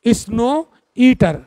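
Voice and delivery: Snow ईटर drawn out slowly, word by word; slow dictation. Shown as a demonstration. Teacher repeating snow ईटर slowly for students' notes. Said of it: "क्या कहते हैं?".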